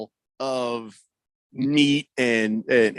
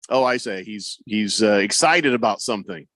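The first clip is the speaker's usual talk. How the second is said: ordinary talk, nothing marked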